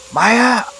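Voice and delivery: high, alarmed call of a name; sharp high-pitched yell; shout. A narrator's reading. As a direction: cry out after her. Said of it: "teriak Agung".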